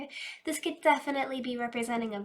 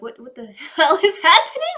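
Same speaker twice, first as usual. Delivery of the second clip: loud, laughing mock confusion; hammed-up baffled outburst through laughter; laughing disbelief; 4 semitones higher, 13 dB louder than usual. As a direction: blurt it out, cracking up. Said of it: "You're like".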